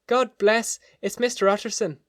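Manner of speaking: high, loud cry of relief. A narrator's reading. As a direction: cry out like an overwrought cook greeting a rescuer. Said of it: "the cook, crying out".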